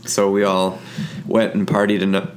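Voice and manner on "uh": ordinary delivery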